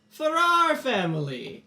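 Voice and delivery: high, loud, drawn-out hail; calling out. Acted. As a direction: announce yourself to the whole family, slowly. Lord calls out to the family.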